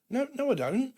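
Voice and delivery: very high-pitched protesting denial; mock protest. Refusing partner answering back in a squabble, high mock protest. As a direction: act out a squabbling comeback. Acted back-and-forth quarrel.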